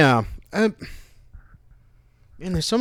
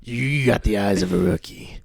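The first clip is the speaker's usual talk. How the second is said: brooding tough guy; 5 semitones lower, 4 dB quieter than usual. Pretend. Gruff movie-line impression.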